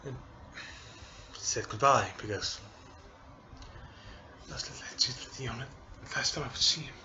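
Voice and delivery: tearful, halting words between sighs; choked up, apologising while crying; real crying. Real, not acted. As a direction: speak through real tears at the father's funeral memory. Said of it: "I'm actually crying over here".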